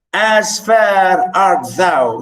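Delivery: slow, drawn-out verse; poem reading. Acted. Teacher quoting the poem to class, slow recitation. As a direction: linger on every word of the verse.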